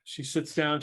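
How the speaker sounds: ordinary delivery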